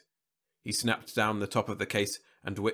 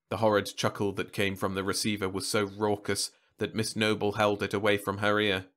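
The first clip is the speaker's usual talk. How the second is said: ordinary delivery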